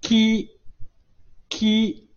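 舌位隆起反复读ki; model ki. Shown as a demonstration. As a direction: drill ki for the class. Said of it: "舌位也要隆起啊".

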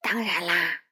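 a gentle, slightly low straw man; scarecrow voice. Acted.

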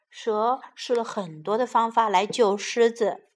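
ordinary delivery, nothing marked

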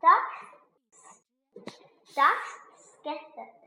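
plain reading voice

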